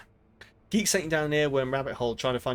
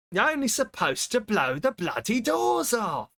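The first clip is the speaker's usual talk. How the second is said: shouted film quote; 8 semitones higher than usual. Retold or imitated. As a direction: belt out the famous line. Shouting the famous film line.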